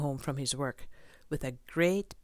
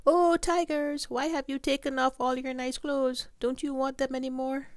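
calling out; 11 semitones higher, 4 dB louder than usual. Acted. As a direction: call far across to the tigers. Boy calls out to tigers.

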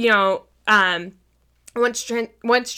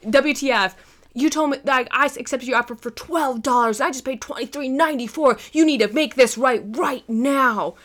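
higher-pitched mock indignation; mad all-caps email; 4 semitones higher than usual. Angry buyer emails seller about shipping charges, mildly acted outrage. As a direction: read it out like an irate customer's demand. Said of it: "in caps because you really know they're fucking mad".

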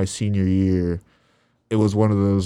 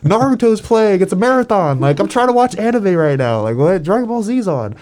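put-on much higher, louder whining teen; whiny kid; 11 semitones higher, 4 dB louder than usual. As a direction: complain like a kid missing his anime. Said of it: "to where we're like".

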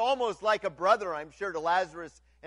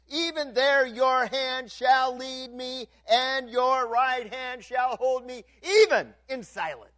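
loud, high, ringing proclamation; preaching at full force; 6 semitones higher, 7 dB louder than usual. Acted. Pastor declaiming Psalm 139 to the congregation, loud and high. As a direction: proclaim the psalm to the whole church.

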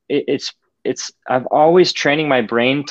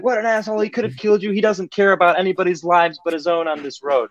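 much higher, hammed-up road rage; imitating an angry rant; 7 semitones higher than usual. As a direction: play the friend ranting at a reckless driver. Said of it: "He's like".

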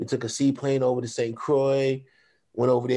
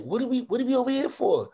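high-pitched imitation of a puzzled wife; mock bewildered; 11 semitones higher, faster than usual. Wife questioning the detour, mock bewildered. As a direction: mimic her questioning, voice pitched way up.

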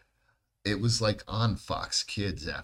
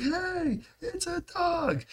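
put-on very high, slow squeaky man-child voice; Pee-wee voice; much slower than usual. Retelling the episode, Pee-wee spots the dog in a squeaky high voice. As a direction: do Pee-wee's giddy squeal. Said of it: "He's like".

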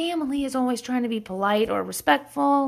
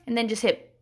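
ordinary talk, nothing marked

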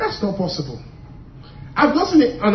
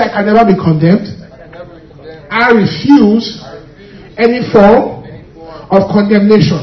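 loud declaration; 5 dB louder than usual. Shown as a demonstration. Preacher leads the congregation in a loud declaration. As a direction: declare it with force. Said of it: "Come on, say".

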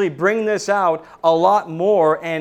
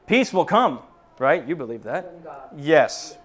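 usual speaking voice

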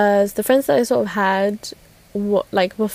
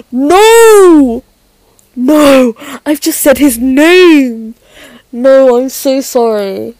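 loud, drawn-out high wail of dismay; horrified outcry; 4 semitones higher, 8 dB louder, much slower than usual. Real, not acted. Speaker who just said his name on air, horrified outcry. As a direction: cry out at your own blunder.

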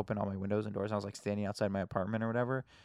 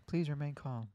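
softer robot reassurance; calm AI voice; 5 semitones higher, 5 dB quieter than usual. Retold or imitated. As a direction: soothe like a care robot. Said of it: "with, like, a calm A I voice".

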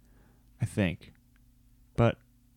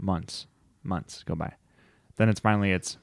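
ordinary delivery

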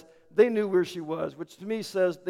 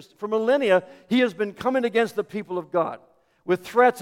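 louder, rising sermon drive; preaching at full force; 3 semitones higher, 5 dB louder than usual. Acted. Pastor preaching the devil's defeat, louder and rising. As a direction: preach it with mounting fire. Preaching builds toward climax.

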